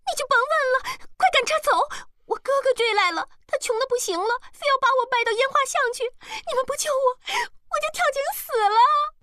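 very high, frantic wailing; trembling yells. A narrator's reading. Girl begs rescue from her brother, frantic trembling yells. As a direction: shriek out a desperate plea. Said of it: "浑身哆嗦， 大叫大嚷".

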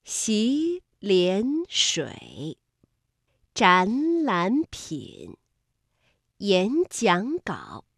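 洗脸水, 展览品, 演讲稿 slowly, first two syllables rising; drawn-out 洗脸水, 展览品, 演讲稿 as tones two-two-three; tone sandhi demo. Shown as a demonstration. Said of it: "前两个字为一组".

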